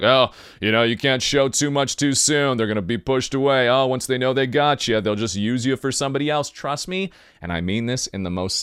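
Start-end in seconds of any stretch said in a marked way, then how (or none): 0.0-6.5 loud caricature of naysayers; mocking advice-givers. Imitating people warning about love, loud and mocking. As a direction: parody bad advice, starting loud and easing off.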